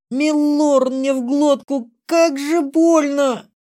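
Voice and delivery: высокий, протяжный женский вопль от боли; кричи от боли, высоко и тягуче; крик боли. A narrator's reading. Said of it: "Разнёсся крик из-за двери". (вопль роженицы)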